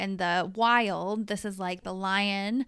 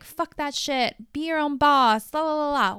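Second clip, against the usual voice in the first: higher and louder, mocking a slogan; mock feminist rallying cry; 5 semitones higher, 7 dB louder than usual. Mocking feminism's message to women, hammed-up high chant. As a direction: lightly ham up the empowerment chant. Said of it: "feminism's like".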